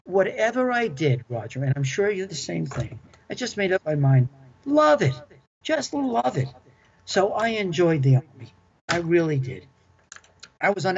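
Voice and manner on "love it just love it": emphatic. Real, not acted. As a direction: sell it with real joy. Emphatic life advice.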